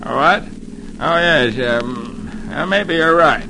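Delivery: mildly nervous, hesitant agreement. A narrator's reading. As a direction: stammer a nervous little concession. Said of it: "looks twice as nervous and exclaims".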